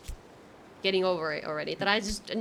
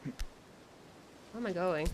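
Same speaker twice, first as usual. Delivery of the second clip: scared whisper; 4 semitones lower, 11 dB quieter, much slower than usual. Real, not acted. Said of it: "I'm kinda scared".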